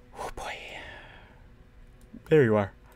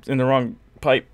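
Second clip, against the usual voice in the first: hiccuping; 3 semitones higher, 5 dB louder than usual. Real, not acted. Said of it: "Why am I suddenly hiccuping".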